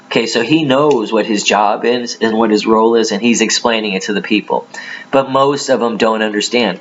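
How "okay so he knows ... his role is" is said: ordinary talk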